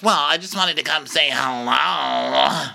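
high, slow wailing mock cry; mock whiny Chanel. Imitating Chanel's fake hurt, high slow wail. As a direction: whine it like a hurt diva. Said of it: "And she goes".

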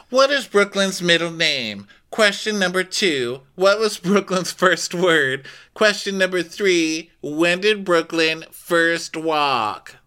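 high, measured question reading; mock quiz hostess. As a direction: imitate a hostess solemnly announcing trivia.

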